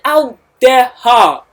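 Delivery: loud, slow, thick indignant voice; outraged royal bellow, drawn out; thick-voiced kings. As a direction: boom it out like an offended old king. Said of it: "their tick, tick voice".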